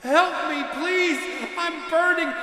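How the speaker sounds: put-on high, very loud child's shriek; blasting scream. A narrator's reading. Ghost child screaming that it is burning. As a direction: scream it through the whole house. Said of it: "blasted out".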